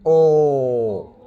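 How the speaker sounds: "Oh" as a long dark L, falling. Shown as a demonstration. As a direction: hold a hollow, back-of-the-tongue ull for "Oh". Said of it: "practice making the dark L".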